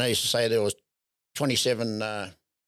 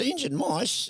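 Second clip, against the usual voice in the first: 1 incredulous; 10 semitones higher, slower than usual. Retold or imitated. Owner repeats the RSPCA claim.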